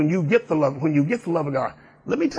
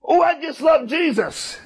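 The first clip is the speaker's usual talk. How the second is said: mock gushing believer; 10 semitones higher than usual. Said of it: "You've seen them".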